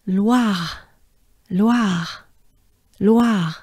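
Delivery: "Loire" with a "lwa" sound and a light French r; French r drill. Shown as a demonstration. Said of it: "it goes like this".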